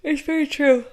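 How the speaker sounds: said through a yawn. Real, not acted. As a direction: yawn while you talk. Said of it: "Sorry, I'm yawning".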